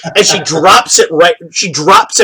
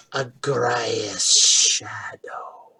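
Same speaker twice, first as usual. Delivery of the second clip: drawn-out, voiceless whisper; slow whisper; 5 semitones lower, 11 dB quieter, much slower than usual. Acted. Creature repeats the name it was given, slow whisper. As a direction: whisper the name very slowly, tasting it.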